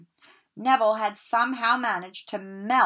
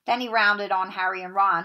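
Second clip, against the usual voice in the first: plain reading voice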